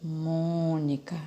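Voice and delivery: "Mônica" drawn out slowly and softly; quietly testing "Mônica" for its strong syllable; pupil sounding out. Retold or imitated. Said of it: "Ana Beatriz respondeu pra tia".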